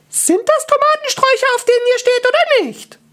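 put-on very high squeaky manga girl; shrieky little cartoon voice; challenging cartoon girl. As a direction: squeak the challenge at top pitch. Garden girl challenges the dwarf.

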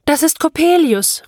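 high cry of alarm; horrified cry. Acted. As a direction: call it out in horror, pitch leaping. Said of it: "rief ich entsetzt". (entsetzt und hoch aufgeschrien)

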